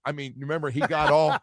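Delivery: ordinary delivery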